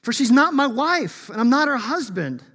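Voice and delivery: loud, high, pleading call to the mother; God as an anguished husband, pleading loudly; pleading cry. Acted. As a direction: cry out begging the kids to plead with her. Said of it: "And he says this, he goes".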